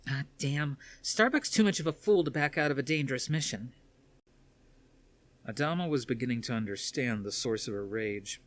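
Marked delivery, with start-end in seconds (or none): 0.1-3.7 put-on high young woman's voice, louder and pleading; a daughter storming in to confront her father; upset daughter. Athena rages about Starbuck.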